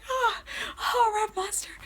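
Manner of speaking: very high, airy whispered squeal; swooning fan reaction. Retold or imitated. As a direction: swoon over your idol, re-enacted. Retells her own swooning reaction.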